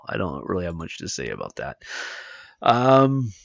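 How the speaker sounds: hoarse, tired voice; worn-out, hoarse voice. Real, not acted. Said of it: "I'm already losing my voice".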